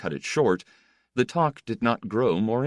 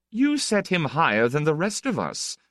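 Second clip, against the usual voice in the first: higher-pitched, ironic teasing; 10 semitones higher than usual. A narrator's reading. The Count teases an admiring guest, ironically. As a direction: say it through an ironic smile. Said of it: "said with his ironic smile".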